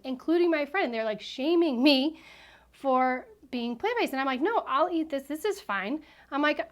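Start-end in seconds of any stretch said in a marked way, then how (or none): 4.4-6.0 higher-pitched, light protest that all is fine; retold insistent reassurance. Guest answering the laughing ladies, retold insistent reassurance. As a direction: imitate yourself brushing off teasing.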